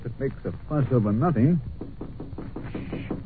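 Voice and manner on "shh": hushing. Acted. Hushing before visitor enters.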